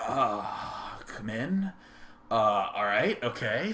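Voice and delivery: low, very soft, hesitant welcome; retold shy fumbling; awkward, nervous. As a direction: mumble it softly like a nervous boy. Retold nervous young self at door.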